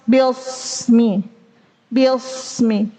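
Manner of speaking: BILSMI with a heavy s like sod; thickened sin. Shown as a demonstration. Teacher showing the mistake, sin thickened into sod. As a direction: darken the vowel and s in BILSMI. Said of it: "keliru juga kalau menebalkan huruf sin".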